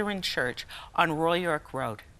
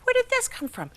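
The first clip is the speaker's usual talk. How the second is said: amazed disbelief. Retold or imitated. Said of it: "I thought".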